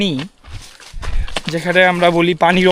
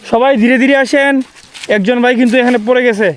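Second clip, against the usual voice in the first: man down shout; 6 semitones higher than usual. Real, not acted. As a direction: call back to warn those behind. Warning group about fallen man.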